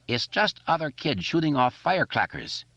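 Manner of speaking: put-on a kid keeps up his joke Chinese accent; mock Chinese accent. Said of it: "doing his Chinese coolie voice".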